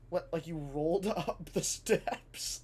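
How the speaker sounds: laughing while asking. Retold or imitated. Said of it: "And she goes".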